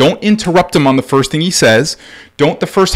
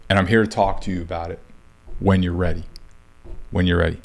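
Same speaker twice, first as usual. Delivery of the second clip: low, soft fatherly voice; gentle reassurance; 7 semitones lower, 9 dB quieter, faster than usual. Acted. Dad tells son he can talk when ready, soft and gentle. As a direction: offer it softly and low, with space between phrases.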